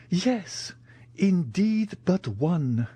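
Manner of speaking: put-on high, wistful old major; sighing. A narrator's reading. Major admits one thing is missing, with a sigh. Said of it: "said the major with a sigh".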